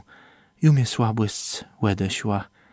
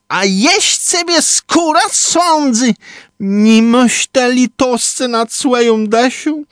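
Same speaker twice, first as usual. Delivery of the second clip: shouted; 13 semitones higher, 4 dB louder than usual. A narrator's reading. Trumba crying out to the sky in a high otherworldly voice.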